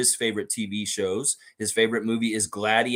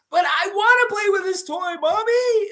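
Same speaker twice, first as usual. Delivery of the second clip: put-on high, loud whining kid voice; whiny child; 6 dB louder than usual. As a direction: beg like a spoiled little kid. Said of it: "you're the child in the room".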